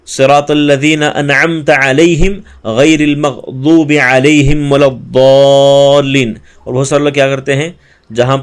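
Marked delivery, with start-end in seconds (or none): 0.1-6.3 slow recitation. Shown as a demonstration. Teacher models the final verse as slow recitation. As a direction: recite the closing verse slowly and fully. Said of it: "پھر اسی طرح".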